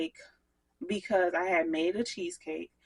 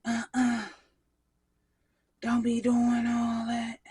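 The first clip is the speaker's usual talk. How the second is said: scolding voice; 5 semitones higher, 7 dB louder than usual. Retold or imitated. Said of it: "My asthma, like".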